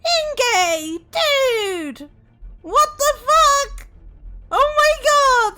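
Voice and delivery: loud, high shocked yelling; losing it over the gift; freaking out. Real, not acted. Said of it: "my freak out".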